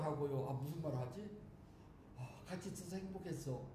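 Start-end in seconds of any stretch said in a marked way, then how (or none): 2.2-3.6 같이 said softly, falling, hushed much softer; soft farewell words. Shown as a demonstration. Practicing last words lying in the dark, softly. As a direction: murmur it as a gentle last goodbye. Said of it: "좀 이게 식상할 것 같아".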